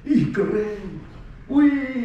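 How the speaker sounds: high, giggly wow; acted squeal of delight; thrilled. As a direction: marvel like a delighted rider. Acts thrilled rider at the top.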